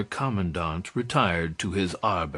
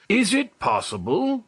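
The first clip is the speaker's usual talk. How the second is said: high, amazed disbelief; astonished cry; 11 semitones higher than usual. Acted. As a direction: cry out as if seeing a ghost. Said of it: "cried the commandant".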